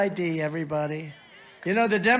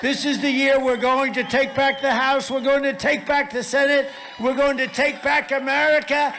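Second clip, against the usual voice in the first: rally speech; 4 semitones higher than usual. Real, not acted. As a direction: pump up the crowd, building. Rally crowd chant-up.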